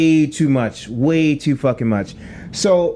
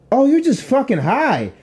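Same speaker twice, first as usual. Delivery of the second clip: higher, drawn-out realization; retold dismissive verdict; 9 semitones higher than usual. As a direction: stretch it out, mimicking the unimpressed friend. Acts friend's verdict on him.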